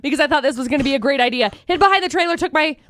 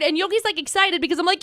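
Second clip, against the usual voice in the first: usual speaking voice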